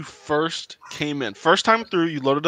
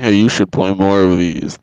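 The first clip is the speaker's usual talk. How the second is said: lower, slow, louder goofy fan voice; mock commenter voice; 10 semitones lower, 6 dB louder than usual. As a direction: ham up a viewer's request. Mocking future commenters.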